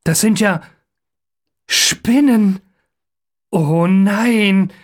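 dawning horror, pitch climbing; rising, drawn-out dread; afraid of spiders. A narrator's reading. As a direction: recoil from spiders. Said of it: "große Angst vor Spinnen".

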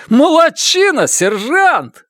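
high, loud delighted praise. A narrator's reading. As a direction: cheer the sergeant happily. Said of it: "радуется капрал Бенджамин". (радостно)

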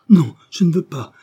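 high, loud desperate no; loud refusal. Acted. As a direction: shout the refusal in fear. Child refuses, pleading. (refus crié)

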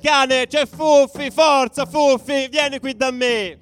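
very high, loud, slow sing-song call to a dog; calling out loudly. Retold or imitated. Fans yelling to the police dog, loud drawn-out call. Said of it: "cominciamo a urlare".

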